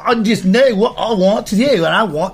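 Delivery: high, slurred Cockney caricature; put-on Cockney girl voice; mock Cockney. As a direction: exaggerate a high-pitched Cockney girl's accent. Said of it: "found a chick with a Cockney accent".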